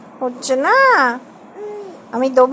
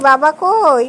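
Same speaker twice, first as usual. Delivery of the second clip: usual speaking voice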